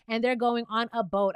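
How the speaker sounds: normal talking voice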